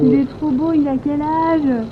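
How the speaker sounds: imitating admiring strangers. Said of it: "ils disent".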